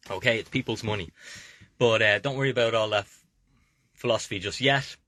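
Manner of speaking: usual speaking voice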